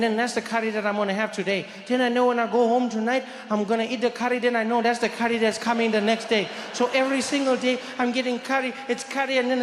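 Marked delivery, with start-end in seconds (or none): none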